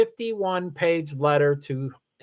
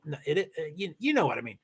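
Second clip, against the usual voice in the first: ordinary talk, nothing marked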